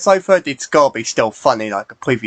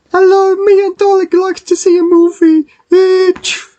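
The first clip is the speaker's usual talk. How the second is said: much higher squeaky toy voice; high character voice; 5 dB louder than usual. Acted. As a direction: voice a little high-pitched friend.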